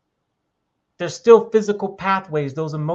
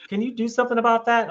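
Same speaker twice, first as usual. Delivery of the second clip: mock wife; 8 semitones higher, faster than usual. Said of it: "she goes".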